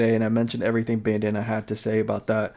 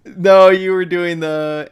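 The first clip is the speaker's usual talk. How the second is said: laughing; 7 semitones higher, 11 dB louder, slower than usual. Real, not acted. Laughing at friend's voice.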